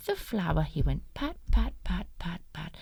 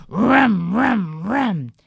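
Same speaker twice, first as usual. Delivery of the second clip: motorbike noise; 10 dB louder, slower than usual. Acted.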